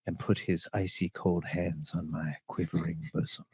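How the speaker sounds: soft, low sensual reading; lady audiobook narrator. Pretend. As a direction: read it like a quiet erotic audiobook. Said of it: "if a lady's like".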